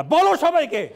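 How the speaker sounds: high, loud scolding command; hammed-up angry parent shout; shouted parental order. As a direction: yell at a shy child to perform. Parent ordering shy child to perform.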